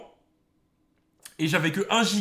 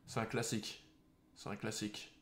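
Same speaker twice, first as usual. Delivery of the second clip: fading repetition; 5 semitones lower, 15 dB quieter than usual. Real, not acted. Repeats, fading away.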